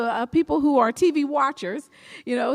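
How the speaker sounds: normal talking voice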